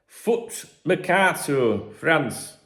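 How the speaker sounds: put-on high, slow try at a French accent; attempted French accent. Podcaster attempting the French source name, tentative French accent. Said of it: "How do you do French accent?".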